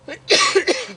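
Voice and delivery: firm throat clear against a tickle. Real, not acted. Coach fighting a throat tickle, clearing his throat. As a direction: clear the throat hard before going on. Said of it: "I got a little tickle in my throat".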